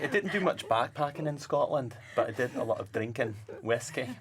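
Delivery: put-on cheerful Scottish accent, laughing. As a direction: try out a Scottish accent, amused. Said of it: "you have to do a Scottish accent now".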